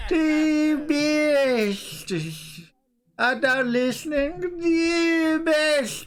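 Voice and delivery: high, slurred holy man voice; mock drunk vicar. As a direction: ham up a tipsy preacher.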